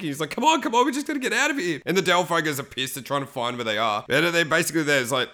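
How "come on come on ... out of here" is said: high, mock-anxious urging; imitated panicky pleading; panicking. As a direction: press them nervously to get moving.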